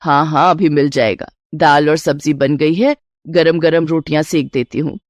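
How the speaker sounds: unruffled mother of the house; placating mother-in-law. Acted. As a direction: answer him patiently in a low voice. Mother-in-law answers son.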